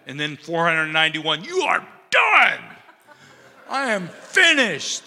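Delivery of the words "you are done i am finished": hammed-up shout, high and slow; mock exasperated shout. Jesus pretending to snap at the 491st offense, hammed-up shout. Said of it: "Jesus was just like".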